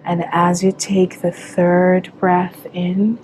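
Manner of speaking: calm meditation guide with long pauses; unhurried, measured visualization voice; guided meditation. Acted. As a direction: lead them into relaxation, phrase by phrase.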